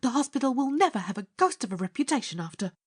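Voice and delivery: high, rapid scolding; outraged nurse. A narrator's reading. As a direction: scold him indignantly in a rush.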